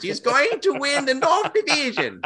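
high cartoon villain voice through a laugh; mock the coach as a cartoon villain, laughing; Doofenshmirtz voice, laughing. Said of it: "that's how it sounds like".

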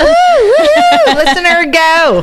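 very high, loud whooping cheer. Real, not acted. Host cheering the listener to press play, whooping high and loud.

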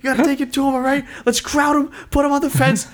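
high, urgent corner coach shout; coach yelling. Retold or imitated. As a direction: fire up your fighter between rounds. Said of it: "the coaches are yelling".

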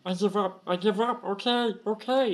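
high, panicked pleading; surrendering kobold. Acted. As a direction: beg for mercy in a high scared voice. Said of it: "he goes".

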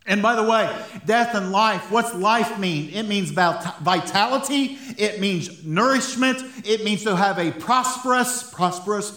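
high, loud, impassioned sermon; fervent preaching. Real, not acted. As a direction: preach it with rising conviction, urging the room on. Preaches at full force.

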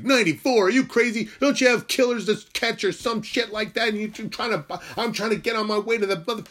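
high, loud, exasperated tirade; mock outraged rant. Pretend rant at the motorcycle cop about speeding, loud and high-pitched. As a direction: rant at the cop in hammed-up disbelief.

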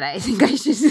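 words breaking into laughter; laughing. Real, not acted. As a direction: laugh while asking. Joking plea for advice, laughing.

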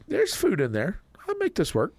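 high, eager dog voice; squeaky thrilled puppy; excited dog voice. Retold or imitated. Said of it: "be like".

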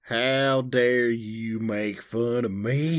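hammed-up Southern indignation; indignant Southern. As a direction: drawl it out as mock offence in Southern. Mock Southern indignation at being teased.

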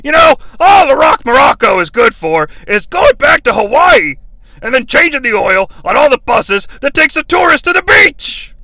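high, loud sneering shout; mock Bobby Heenan. The Brain mocking The Rock, high loud shout. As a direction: sneer it like a smug heel. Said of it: "Bobby the Brain chimes in".

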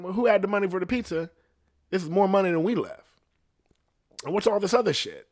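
high, fast interrogating dad; dad questioning the pizza money; suspicious dad. Retold or imitated. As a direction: rattle off questions like a suspicious father.

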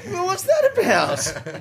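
high, slow incredulous question; mock disbelief. Real, not acted. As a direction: play up the outrage. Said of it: "mock disbelief".

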